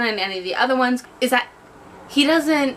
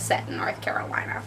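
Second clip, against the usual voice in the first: whispered aside; 7 dB quieter than usual. Real, not acted. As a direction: whisper it like a secret.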